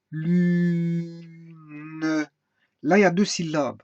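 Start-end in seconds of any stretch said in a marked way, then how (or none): 0.1-2.0 "Lune" sustained, with the final e sounded; two-syllable breakdown. Shown as a demonstration. Teacher splitting "Lune" into two long syllables. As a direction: stretch "Lune" into lu-ne. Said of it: "on a décomposé en deux syllabes".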